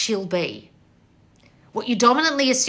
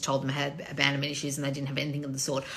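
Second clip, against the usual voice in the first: ordinary talk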